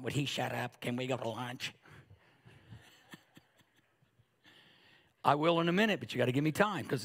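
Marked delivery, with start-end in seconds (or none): 0.0-1.7 softer, lower mock grumble; muttered impatience. Bored churchgoers grumbling about the preacher, muttered. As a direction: mimic bored listeners under their breath. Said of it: "faces and people that are going".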